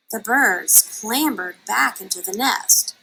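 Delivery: ordinary delivery, nothing marked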